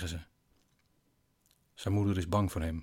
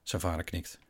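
plain reading voice

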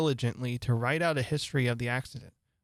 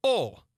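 All punched higher as one weighty word; great emphasis; 7 semitones higher, 4 dB louder than usual. A narrator's reading. King repeats All to Alice with great emphasis. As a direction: hit All hard, pitched up. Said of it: "repeated with great emphasis".

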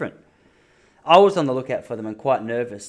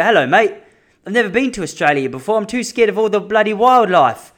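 higher, louder mock British tourist; British accent; 6 semitones higher, 5 dB louder than usual. Londoner telling an Aussie he fears wildlife, mock British accent. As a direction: put on a proper English accent, a bit hammed-up. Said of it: "'cause they're British".

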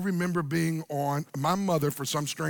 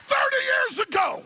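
much higher mock-horrified yell from the pews; mock scandalized shout; 6 dB louder than usual. Pastor mimicking shocked churchgoers, mock-horrified shout. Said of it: "Some of y'all are like".

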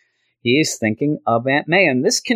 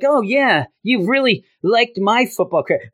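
bragging Flash; 8 semitones higher than usual. Retold or imitated.

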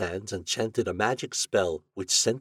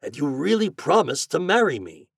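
high, insistent storybook plea; mildly wounded appeal; pleading; 7 semitones higher, 5 dB louder than usual. A narrator's reading. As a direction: press the plea with a lifted voice. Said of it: "pleaded the glassblower".

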